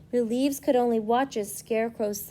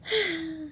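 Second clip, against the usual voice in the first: sigh; 6 semitones higher, 3 dB quieter than usual. A narrator's reading. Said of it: "said with a sigh".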